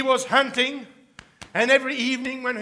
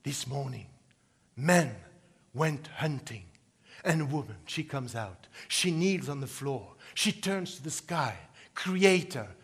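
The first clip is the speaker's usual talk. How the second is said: much softer, hushed storytelling voice; hushed narration; 10 semitones lower, 9 dB quieter than usual. A narrator's reading. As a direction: draw the listeners in with a near-whisper.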